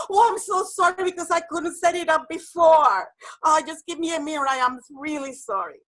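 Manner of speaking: high, loud, rushed sorry-gushing; panicky apology. Retold or imitated. Presenter fumbling her PowerPoint, loudly apologizing, panicky. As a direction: cry out apologies while fumbling the setup.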